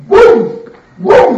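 loud high mock boom; kids' story sound effect. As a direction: punch out a cartoon boom. Imitates them being kicked out.

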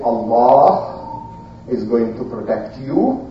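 low, soft, measured angel; steady him with quiet certainty; calm reassurance. Acted. Angels answering the frightened prophet, low calm reassurance.

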